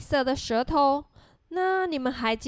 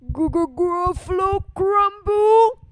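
loud, higher monster voice stuttering in mock fright; frightened stammering Gruffalo; 6 semitones higher, 7 dB louder than usual. Gruffalo learns he is mouse's food, stammering in fear. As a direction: stammer the first sound, then blurt it out.

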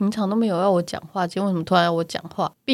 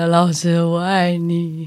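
very slow, dreamy "老师，我爱你"; dazed slow confession; 3 semitones lower, much slower than usual. Retold or imitated. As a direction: drag it out like a dazed patient. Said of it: "那我就再说一次".

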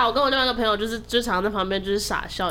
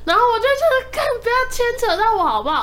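higher and louder, fed-up venting; exasperated outburst; 13 semitones higher, 6 dB louder, faster than usual. Retold or imitated. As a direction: blurt it out like you've had enough of their fighting. Fed up with the bickering couple. (受够了，拔高音量地抱怨)

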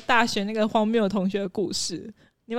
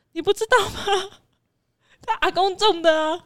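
imitating classmate; 9 semitones higher than usual. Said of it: "他就说".

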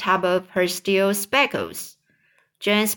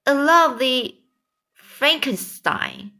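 higher, mocking jab, slowing on Frankenstein; sneering aunt; 5 semitones higher than usual. A narrator's reading. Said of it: "Anne Spiker said".

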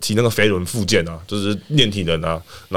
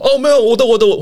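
higher, louder flustered apology; flustered player; 11 semitones higher, 5 dB louder than usual. Retold or imitated. Player rushing to take the blame, higher and flustered. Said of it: "然后黄正说".

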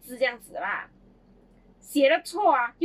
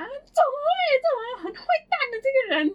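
much higher, squeaky mock outrage; mock indignant kids; 12 semitones higher, 5 dB louder than usual. Kids pretending to be shocked at mum, high mock outrage. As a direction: play the cheeky kids' fake protest. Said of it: "然后我们讲".

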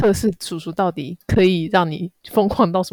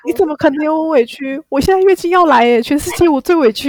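mock self-pity; 7 semitones higher, 7 dB louder than usual. Mocking PMS self-pity.

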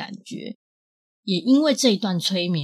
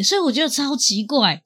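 normal talking voice

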